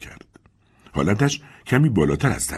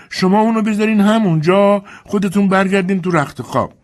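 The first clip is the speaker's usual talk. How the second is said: put-on high, raised old servant voice calling upstairs; Dilsey calling up; 14 semitones higher, 6 dB louder than usual. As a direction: call up the stairs to the mistress.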